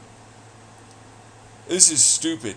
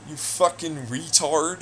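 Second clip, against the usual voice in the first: ordinary delivery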